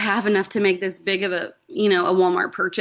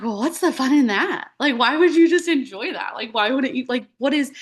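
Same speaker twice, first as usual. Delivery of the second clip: teasing upline voice; 5 semitones higher than usual. Retold or imitated. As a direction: tease her like it's obvious fun. Mocking upline Kinley's playful push.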